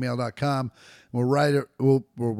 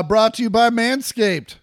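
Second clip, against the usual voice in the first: ad read; 8 semitones higher, 9 dB louder than usual. Acted. Sponsor plug.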